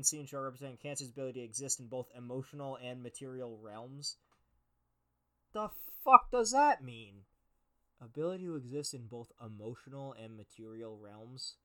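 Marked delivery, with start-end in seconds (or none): 5.5-7.2 incredulous outburst. Real, not acted. Ranting at horoscope text.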